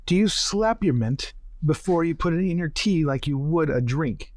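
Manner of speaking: ordinary delivery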